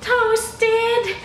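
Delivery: high loud cry; shocked outburst. Acted. Customer shrieking at the toast question, high and loud. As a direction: shriek it in horror.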